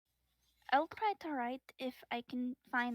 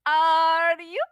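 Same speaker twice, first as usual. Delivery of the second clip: escalating teasing question; 14 dB louder, slower than usual. Acted. Player repeating teasing question back.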